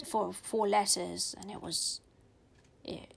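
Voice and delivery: usual speaking voice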